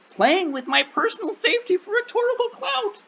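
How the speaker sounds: high, silly clown voice; mock it like a goofy clown. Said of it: "I felt like saying that like a clown".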